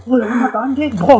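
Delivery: high shout to the real friend; relieved, rowdy greeting; cheerful call. Retold or imitated. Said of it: "Iya aku".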